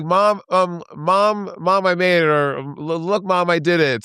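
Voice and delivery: high mock proud call; calling to mom. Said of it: "she's like".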